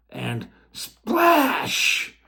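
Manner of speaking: a sudden high, loud cry of splash; big splash cry. Acted. The narrator cries out the stone's huge splash into the pot. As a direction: burst out with the splash.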